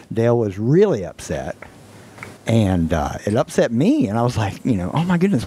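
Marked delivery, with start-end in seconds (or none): 4.9-5.4 a startled oh my goodness, pitched up; lightly replayed shock at a friend's distress; alarmed. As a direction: gasp it out in sudden worry. Alarmed reaction to upset friend.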